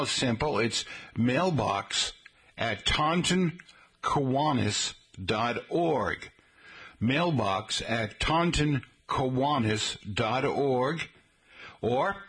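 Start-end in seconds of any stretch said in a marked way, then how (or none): 0.6-6.3 slow, spelled-out email address; careful radio dictation. Shown as a demonstration. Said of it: "spelling out the email slowly". 7.0-11.1 slow, deliberate repeat of the address; slow careful dictation. Shown as a demonstration. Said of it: "repeating the email slowly".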